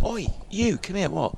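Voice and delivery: high, sharp call across the club; mock calling someone over; imitating a high "oi" call. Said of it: "I'm like".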